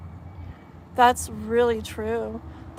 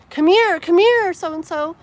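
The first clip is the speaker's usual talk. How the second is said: put-on high, loud call after a runaway kid; 7 semitones higher, 9 dB louder than usual. Adult chasing a little kid near pokey grass, calling out. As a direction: call out urgently, as if chasing a toddler.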